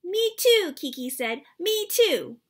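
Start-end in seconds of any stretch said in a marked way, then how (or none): 0.0-0.7 put-on high, loud tiny-kid chirp; little sister voice. Little sister copying her siblings, high chirp. 1.6-2.4 put-on high, loud tiny-kid chirp; little sister voice. Little sister copying her siblings, high chirp.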